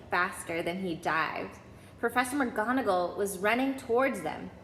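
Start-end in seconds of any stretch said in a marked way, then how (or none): none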